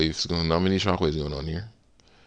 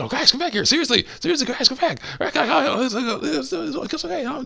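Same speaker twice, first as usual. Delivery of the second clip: very high, pleading falsetto; pleading walrus cook. Retold or imitated. Walrus cook calling his prey back, wheedling falsetto. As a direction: wheedle in a squeaky-high comic villain voice. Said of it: "doing the same thing with this walrus".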